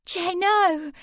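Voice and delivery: high, loud desperate cry; a girl crying out in alarm; high loud shout of protest. A narrator's reading. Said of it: "Sammy cried".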